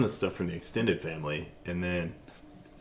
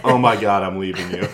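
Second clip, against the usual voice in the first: exasperated wife; 7 dB louder than usual. Acted. Wife storming off, acted.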